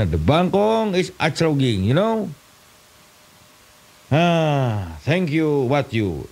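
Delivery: high, slow broken English; mock English drill. Comedian doing a silly English lesson, high slow broken English. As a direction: drill it like a clumsy schoolbook dialogue.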